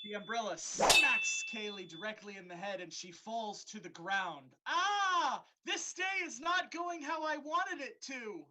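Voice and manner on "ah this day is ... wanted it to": high whiny wail. Acted. Dryad hit, whining.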